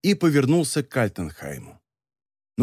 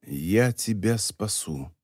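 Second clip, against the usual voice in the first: soft, slow hushed promise; slow whispered promise to the dying baron; 5 semitones lower, 5 dB quieter, slower than usual. A narrator's reading. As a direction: lean in and whisper it slowly. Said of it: "Сернин прошептал".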